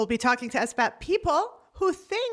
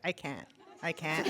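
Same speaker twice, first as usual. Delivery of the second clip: small, much softer helpless voice; meek protester; 8 semitones lower, 10 dB quieter than usual. Retold or imitated. Glued protester answering the cops, meek and quiet. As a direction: sound stuck and sheepish.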